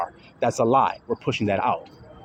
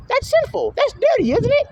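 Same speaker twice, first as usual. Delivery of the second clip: far higher, scandalized mock tone; mock prudish gasp. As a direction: parody the shocked churchgoer, pitched way up. Mocking prudish listeners.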